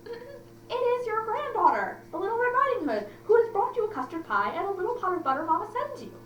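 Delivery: put-on high, sweet little girl voice. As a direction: pitch it up like a small girl. Girl voiced in read-aloud story.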